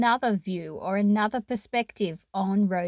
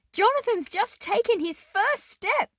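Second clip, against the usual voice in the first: excited yell; 12 semitones higher than usual. A narrator's reading. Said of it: "yelled Jody".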